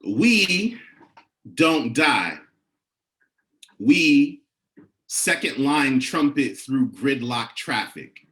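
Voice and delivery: slow, rhythmic poetry performance with emphatic questions; measured, rising performance poetry; spoken word poem. Acted. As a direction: preach each line like a call to the congregation.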